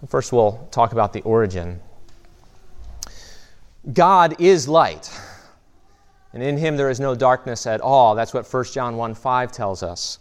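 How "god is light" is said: slow proclamation. Acted. Key doctrinal statement.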